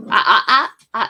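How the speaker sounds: loud sharp ah to stop the dog; real loud warning at the dog. Real, not acted. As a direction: cut the dog off with a sharp sound. Stopping the dog.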